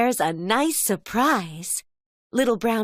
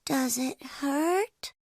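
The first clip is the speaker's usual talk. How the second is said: softer, slow curious cub voice; little bear voice; 4 semitones higher, 6 dB quieter, slower than usual. Acted. Little Brown Bear asking dad while he shaves, soft slow cub voice. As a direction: ask it shyly like a small cub. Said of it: "Little Brown Bear asks".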